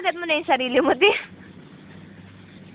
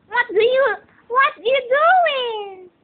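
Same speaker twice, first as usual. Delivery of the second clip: put-on a toy talking to the baby; squeaky toy voice; 7 semitones higher, slower than usual. A mother voicing Mickey Mouse for her baby, squeaky and high.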